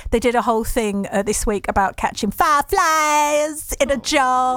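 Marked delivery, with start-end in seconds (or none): 2.3-4.6 fond imitation of an Alabama accent; mimicked southern accent. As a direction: put on a playful southern US twang. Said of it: "I love the southern accent".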